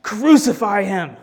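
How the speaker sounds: loud, slow, drawn-out shout; crowd shouting back. A narrator's reading. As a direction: shout it like an angry mob. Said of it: "they shouted back".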